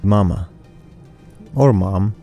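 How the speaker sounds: ordinary talk, nothing marked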